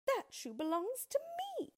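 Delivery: put-on high girlish protest; very high, affronted little girl; indignant cry. A narrator's reading. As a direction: insist indignantly as a child. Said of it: "cried Dorothy".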